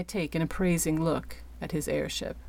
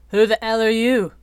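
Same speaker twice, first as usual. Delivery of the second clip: loud, gruff challenge from the captain; angry demand; 5 semitones higher, 9 dB louder than usual. Acted. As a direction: bark it at the stranger. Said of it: "he demanded in reply".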